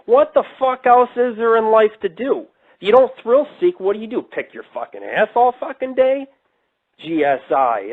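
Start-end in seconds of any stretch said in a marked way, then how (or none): 0.1-6.3 angry outburst. Real, not acted. Ranting back at the critic who wrote him, furious. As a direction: pitch jumps way up in a real furious rant.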